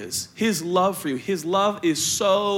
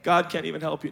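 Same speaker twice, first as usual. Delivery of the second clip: ordinary delivery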